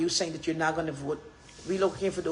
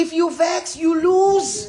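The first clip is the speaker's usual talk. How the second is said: loud, high rallying shout; defiant, raised-voice declaration; 8 semitones higher, 8 dB louder, much slower than usual. Real, not acted. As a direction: shout it out at the crowd.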